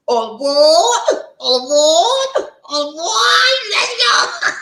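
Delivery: slow, high, stretched all aboard; conductor call. Shown as a demonstration. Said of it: "When I say all aboard".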